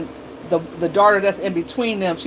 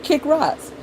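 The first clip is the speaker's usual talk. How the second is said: dismissive; 9 semitones higher than usual. Retold or imitated. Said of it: "I'm like".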